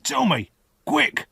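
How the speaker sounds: high, urgent convict's order; growled demand. A narrator's reading. Convict demands the boy's name, urgent growl. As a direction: press urgently, rough and threatening. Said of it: "the man growled".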